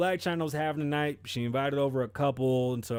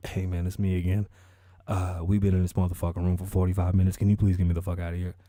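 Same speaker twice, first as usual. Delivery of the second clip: low, pleading caller voice; deep-voiced mock caller, a bit louder; 911 caller; 7 semitones lower, 5 dB louder than usual. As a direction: play the trapped caller, pitched well down. Caller voice returns to operator.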